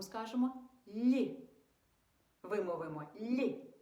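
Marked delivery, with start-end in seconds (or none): 0.9-1.5 «лі» palatalized, higher and louder, with a smile; soft L. Shown as a demonstration. Teacher saying it together with children, soft smiling «лі». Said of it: "Якщо є посмішка". 3.2-3.7 «лі» palatalized and higher, with a smile; soft L. Shown as a demonstration. Teacher repeating the first sound for children, soft «лі». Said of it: "Якщо є посмішка".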